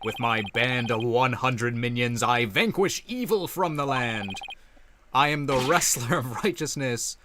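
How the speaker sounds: loud, proud hero proclamation; heroic boaster. Acted. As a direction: announce yourself grandly. Plays Nine Tails hero figure.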